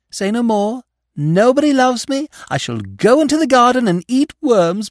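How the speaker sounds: high, hammed-up wounded girl voice; mock tragic girl. Young actress mocking self-pity at a stranger, hammed-up and high.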